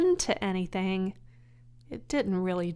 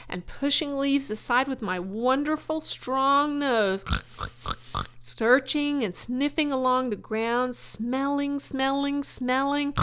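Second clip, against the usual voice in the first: higher, louder, savoring smelling; relishing; 5 semitones higher, 4 dB louder than usual. Acted. Wilbur dreaming of the forest to Charlotte, relishing.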